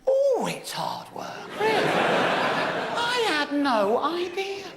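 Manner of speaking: high, slow near-whisper, laughing at his own naivety; slow breathy sarcastic aside; mock sarcasm. Comedian mocking how hard novel writing is, in a high, slow, sarcastic whisper.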